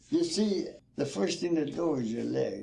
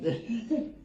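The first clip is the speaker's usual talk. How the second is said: laughing; 10 semitones higher than usual. Real, not acted. Laughs again after story.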